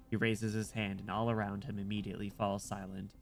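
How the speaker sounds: even, measured narration read; story narration. A narrator's reading. Streamer reading the game's narration to chat, steady narration. Reads game narration aloud.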